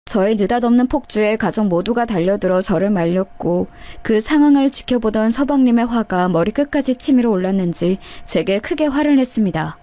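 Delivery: normal talking voice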